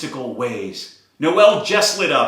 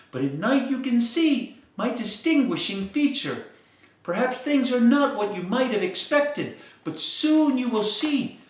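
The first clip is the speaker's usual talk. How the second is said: a higher, gentle firefly comforting a friend; firefly reassuring the sad dog, voiced higher; lightly voiced firefly; 7 semitones higher than usual. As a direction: soothe her like a wise little bug. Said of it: "the firefly spoke".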